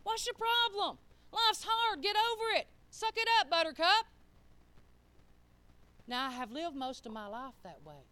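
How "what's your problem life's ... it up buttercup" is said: high, loud and dismissive, brushing off her husband; brash retort. Retold or imitated. Wife snapping back at husband who asks why she talks that way, loud and dismissive. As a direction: toss it back hard and much higher, tough and unbothered. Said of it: "And I'm like".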